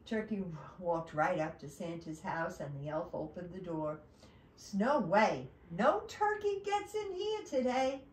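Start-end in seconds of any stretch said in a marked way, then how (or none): 4.7-8.0 high, emphatic storybook elf; loud start, then high-pitched refusal; elf refusing entry. Acted. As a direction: turn the visitor away firmly. Elf refuses turkey at door.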